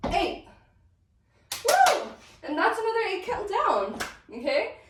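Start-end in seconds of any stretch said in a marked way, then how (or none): 1.7-2.0 a high, loud whoop of joy; excited woo. Real, not acted. Dance teacher cheering after finishing the count, excited whoop.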